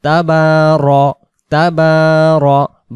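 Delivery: তাবার- said slowly with a short ro, never ra; drawn tabaro. Shown as a demonstration. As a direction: stretch তাবার- and keep the r vowel short. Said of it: "রা হবে না।". (তাবার- ধীরে, রা নয়)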